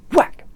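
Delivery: sudden loud, high whack; punched sound-effect word; imitated impact. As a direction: crack it out like metal hitting bone.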